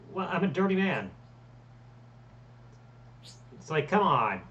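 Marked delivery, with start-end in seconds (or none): none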